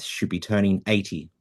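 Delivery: usual speaking voice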